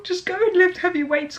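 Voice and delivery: pitched high, half-laughing plea; a playful, overcome sign-off plea; mock emotional. Real, not acted. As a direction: rise up into a mock-tearful appeal. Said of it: "those got me emotional".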